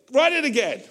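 Paul barking at his scribe to write it again; mock command. Paul insisting his scribe write it again, mock stern. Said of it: "then he goes".

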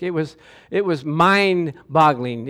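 ordinary talk, nothing marked

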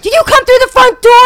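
high, loud interrogating cop; stern cop question. Retold or imitated. Cop questioning the realtor, loud and stern. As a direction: grill her like an officer. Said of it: "he's like".

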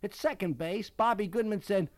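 ordinary delivery, nothing marked

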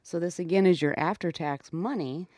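ordinary talk, nothing marked

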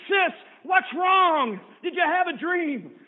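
high, loud, urgent calling out; alarmed shout. Acted. Brother shouting at his hysterical sister, high and loud. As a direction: jolt her awake with your voice.

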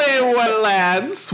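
high-pitched playful welcome; high mock greeting. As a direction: greet the lads in a high silly voice.

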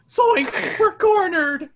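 high, loud panicked yell; Shaggy yelling. Acted. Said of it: "Shaggy yells".

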